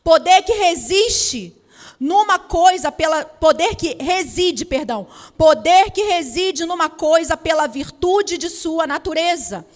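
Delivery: very loud, slow declamation; full-force preaching. Real, not acted. Preacher declaring the meanings of power to the congregation, full-force preaching. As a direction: proclaim each phrase at full volume, drawn out.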